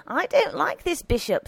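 high-pitched opinionated character voice; disgruntled housekeeper. A narrator's reading. Housekeeper airing her dislike of the bishop, disgruntled. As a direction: declare the dislike, pitched well up.

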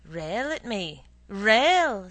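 defiant taunt. Acted. Husband dares wife to scold.

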